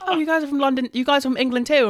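high, loud, excited mock-British voice; British family's accent. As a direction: gush in a put-on English accent. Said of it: "they were like".

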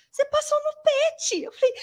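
high, loud, tearful cry of joy; overjoyed disbelief. Retold or imitated. Hearing on the phone that she passed, crying with joy. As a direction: shriek it like you can't believe it.

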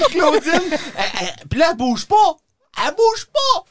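high-pitched alarmed storytelling; retold panic. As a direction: relive the crash in a high, panicky voice. Retells panic at friend's scooter crash. (panique rejouée, voix haut perchée)

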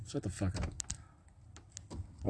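slow hushed whisper to himself; slow unvoiced aside. Real, not acted. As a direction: breathe it out under your breath.

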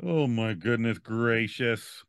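put-on slow drawn-out Minnesota accent. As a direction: stretch it out like a Minnesotan. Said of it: "pull out some Minnesota language".

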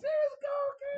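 high-pitched hype; excited. Real, not acted. Hyped reply to the greeting.

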